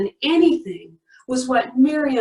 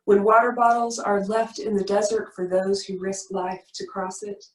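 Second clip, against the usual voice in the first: ordinary talk, nothing marked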